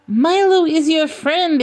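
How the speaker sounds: high-pitched, slow, fawning creature; wheedling creature. A narrator's reading. Creature clutching at a marauder, high wheedling voice. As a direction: plead in a put-on high voice.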